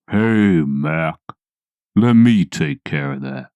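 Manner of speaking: slow, deep, calm big man; deep James Earl Jones voice. Retold or imitated. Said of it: "deep James Earl Jones kind of voice".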